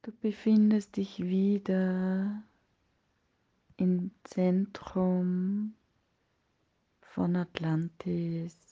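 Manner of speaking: ordinary talk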